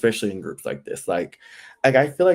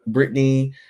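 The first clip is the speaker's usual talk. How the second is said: normal talking voice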